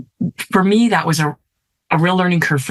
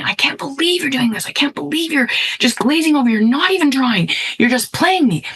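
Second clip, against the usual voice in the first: higher-pitched exasperated scolding of her son; mild mock yell, pitched up, exasperated; retold yelling; 8 semitones higher than usual. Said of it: "I started yelling at me".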